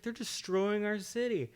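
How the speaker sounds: high-pitched imitation of a woman; quoting the woman in a high voice; imitating woman in car. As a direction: pitch up high to voice her, lightly.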